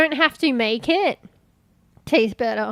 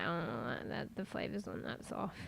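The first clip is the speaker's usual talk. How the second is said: disappointed mutter; 7 semitones lower, 17 dB quieter than usual. Retold or imitated. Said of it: "you're like".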